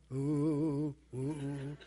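low, eerie mock-mystic ooh; spooky ooh. Preacher mocking the idea of sending thoughts, eerie low ooh. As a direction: moan it like a fake psychic.

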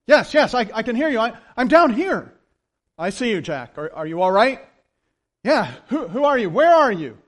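loud, stumbling and frantic; desperate reply. Acted. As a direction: shout back up in panic. Jack shouting up from cliff.

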